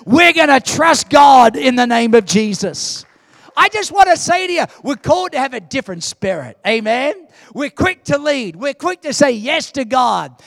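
loud, driving sermon cry; full-force preaching. Acted. Preacher rousing the congregation to trust God. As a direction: preach it out to the crowd.

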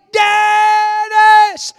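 very high, long-held holler; re-enacted full-voice scream; yelling. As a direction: yell the name so it carries to the house. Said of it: "heard his little brother yelling".

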